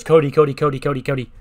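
loud, urgent repeated name-calling; acted panic, loud at the start; mock panicking. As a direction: imitate someone frantically trying to get attention. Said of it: "She's, like, all panicking".

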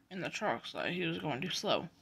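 plain reading voice

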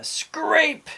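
"scrape" drawn out and pitched high; sound-effect word. A narrator's reading. Narrator imitating a frightening noise, "scrape" high and stretched.

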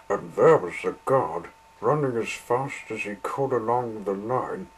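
ordinary delivery, nothing marked

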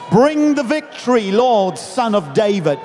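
high, ringing shout of praise; crowd's shout. Retold or imitated. Crowd hails Jesus entering the city, shouting. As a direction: call it out like a rejoicing crowd. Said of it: "They were shouting things like".

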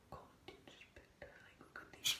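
hushed, voiceless whisper; barely audible whisper. Real, not acted. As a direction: whisper it to the camera. Whispering while showing the room.

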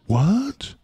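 sudden high what; surprised what. Real, not acted. Streamer startled by chat's nickname, high surprised yelp. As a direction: shoot up in surprise. Reacting to the name.

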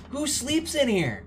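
high, drawn-out incredulous question; disgusted disbelief. Real, not acted. Gamer seeing the grubby bedroom, disgusted disbelief. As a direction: stretch it out high, appalled. Grossed out by the cabin.

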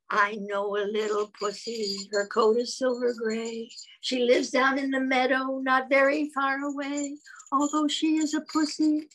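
recited poem. Acted. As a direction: recite it like a nursery rhyme.

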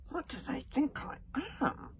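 put-on high child muttering; a hint of an exasperated child; fed-up kid. Child grumbling about the parent: high, lightly voiced exasperated kid. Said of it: "This is the kid speaking about".

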